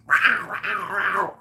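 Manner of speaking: high meowing yowl; the cat calling after him; cat yelling. Retold or imitated. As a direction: meow it like a clingy cat. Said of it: "fucking yelling".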